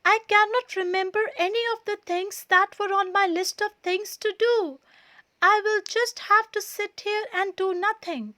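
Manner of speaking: upset high rapid complaint; distressed. A narrator's reading. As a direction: rush it out helplessly. Todd despairing over lost list.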